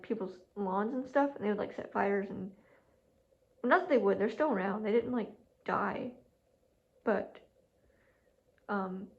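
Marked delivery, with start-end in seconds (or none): none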